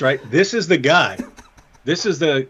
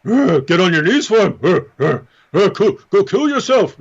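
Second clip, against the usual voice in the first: mock Hijab voice; 3 dB louder than usual. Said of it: "the Khabib of Islamic apologetics".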